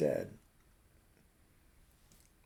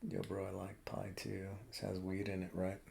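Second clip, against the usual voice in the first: hushed stoner voice; 4 semitones lower, 6 dB quieter than usual. Retold or imitated. Stoner leaning in to his friend, hushed whisper. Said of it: "He's like".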